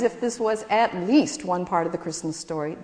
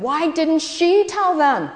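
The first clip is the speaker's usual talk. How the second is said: put-on demanding kid; 9 semitones higher, 5 dB louder, slower than usual. A narrator's reading. Ralph demanding an answer.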